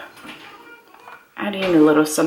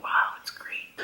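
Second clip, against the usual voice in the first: whispered quote; 8 dB quieter than usual. Retold or imitated. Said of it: "I'm like".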